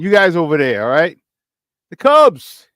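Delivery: loud, hammed-up Chicago accent. Host announcing the Cubs to listeners, loud Chicago accent. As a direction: punch it out like a Chicago fan, accent and all. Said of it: "All right, my Chicago accent".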